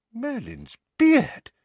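slow, high-pitched astonishment; shocked exclamation. A narrator's reading. Father shocked by the wand standoff.

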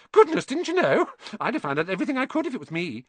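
very high, incredulous, then rattling on; gushing schoolgirl amazed at his ignorance; bossy know-it-all girl, astonished. Acted. Hermione to Harry about his fame, bossily astonished.

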